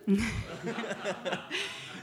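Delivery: a real, easy laugh at being teased; amused laugh. Real, not acted. Wife laughing at husband's question about her age, amused.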